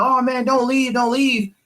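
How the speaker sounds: high, pleading barfly voice; mock the guy begging him to stay; lightly voiced pushy drunk; pushy stranger.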